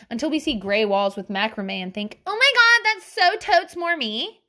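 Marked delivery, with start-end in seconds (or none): 2.3-4.4 high, loud put-on gush; hammed-up high-pitched enthusiasm; mock gushing. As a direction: squeal it like a trend-obsessed fan. Mock gushing over new decor trend.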